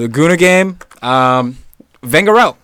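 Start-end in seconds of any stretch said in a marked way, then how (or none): 2.0-2.6 loud, raised rant; excited blast into the mic. Real, not acted. Fan rants against Arsenal's manager, excited and loud. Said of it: "real close up to the microphone. Sorry to blast".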